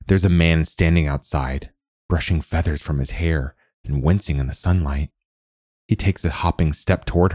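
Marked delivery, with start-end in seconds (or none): none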